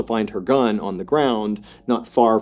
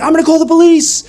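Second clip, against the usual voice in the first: loud, very high acted scream; 7 dB louder than usual. Friend answers Ada over the phone, screaming. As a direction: shout it urgently at the top of the range. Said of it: "the friend is screaming".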